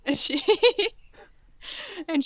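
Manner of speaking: crying with laughter; can barely read through laughing. Real, not acted. Laughing fit while reading.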